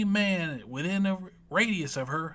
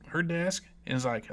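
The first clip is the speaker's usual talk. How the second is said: normal talking voice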